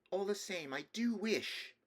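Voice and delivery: put-on high child voice, lingering on the wish; small boy timidly holding to his wish; wistful little boy. Nephew admitting a wish to his uncle, wistful little boy.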